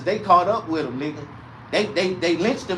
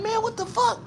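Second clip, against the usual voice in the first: high disbelief; 4 dB quieter than usual. Retold or imitated. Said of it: "I'm like".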